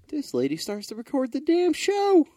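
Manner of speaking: very high, loud incredulous yell; outraged disbelief. Real, not acted. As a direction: build to a loud, scandalized cry. Outraged climax of the story.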